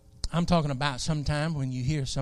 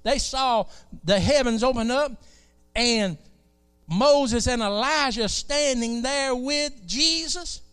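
full-force preaching; 9 semitones higher, 6 dB louder than usual. Acted.

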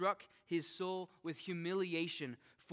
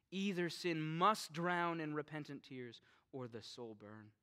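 ordinary talk, nothing marked

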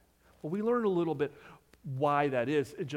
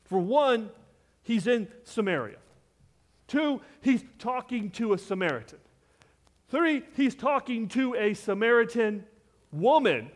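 louder and higher with each count; building indignation; 6 semitones higher, 5 dB louder than usual. Acted. Preacher listing Jesus' broken rules, building louder. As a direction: stack the offences, rising.

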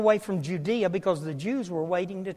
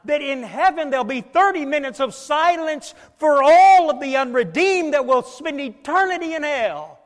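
impassioned preaching; 5 semitones higher, 5 dB louder than usual. Real, not acted. Preacher warns congregation of judgment in heaven, impassioned preaching. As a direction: build the list with heavy, lingering emphasis. Preacher builds throne room scene.

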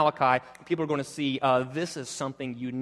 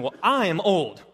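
emphatic, punched "I"; 4 semitones higher, 6 dB louder than usual. Acted. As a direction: stress on "I", raised and louder in "I am old". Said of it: "the sort of this emphatic".

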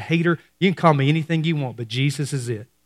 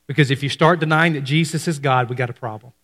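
ordinary talk, nothing marked

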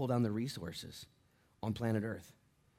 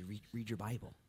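soft advice; 7 dB quieter than usual. Retold or imitated. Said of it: "I say".

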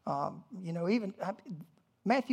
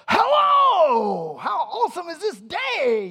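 shouted, much higher, hammed-up cheerful morning greeting; overly cheery greeter; 11 semitones higher, 13 dB louder, slower than usual. Someone greeting you the minute you wake, hammed-up loud cheer. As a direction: burst in like an annoyingly chipper early riser.

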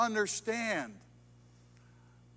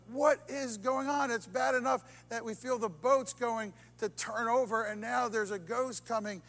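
ordinary talk, nothing marked

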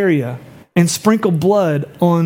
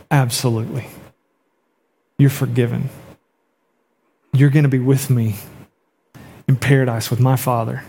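tender Jesus; 4 semitones lower than usual. Retold or imitated. Jesus welcomes the guilty sinner.

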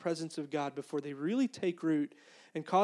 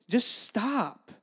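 higher-pitched, abrupt interruption; Holy Spirit voice; 4 semitones higher than usual. Acted. Holy Spirit interrupting the seminary explanation, mock-exasperated. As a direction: cut off the rambling on a raised pitch. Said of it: "if the Holy Spirit talks like this".

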